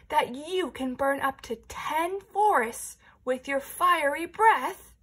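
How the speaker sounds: butter him up in a high voice; princess voice. Acted. Princess questions dragon.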